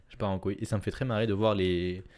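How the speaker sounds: ordinary delivery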